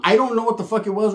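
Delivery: ordinary delivery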